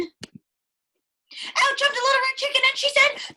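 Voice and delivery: high, excited little chicken bursting in; bright high interrupting chick; squeaky chicken voice. Acted. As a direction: pop in high and eager.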